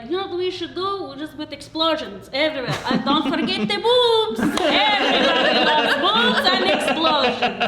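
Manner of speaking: mocking producer voice.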